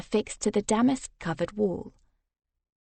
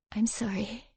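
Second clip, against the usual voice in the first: muffled; 8 dB quieter than usual. Acted. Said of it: "she said in a muffled voice".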